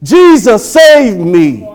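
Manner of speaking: loud, high preacher's cry for rescue. Retold or imitated. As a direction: cry out to Jesus with full force. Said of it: "someone cried out".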